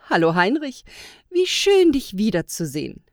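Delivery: loud, happy hello with a high lift; cheerful greeting. A narrator's reading. Woman elf greeting Heinrich off the train, cheerful. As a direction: greet an old friend with open joy. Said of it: "begrüßte sie ihn fröhlich".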